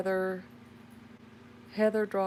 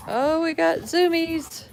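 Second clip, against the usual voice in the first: high, loud, slow exclamation; excited; 12 semitones higher, 9 dB louder, slower than usual. Real, not acted. Mom exclaiming at kids running wild. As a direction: call out over the racket.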